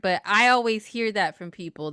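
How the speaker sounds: normal talking voice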